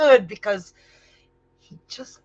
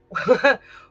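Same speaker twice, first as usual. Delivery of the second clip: incredulous laugh; 3 semitones higher, 6 dB louder than usual. Acted. Stunned disbelief at the insult.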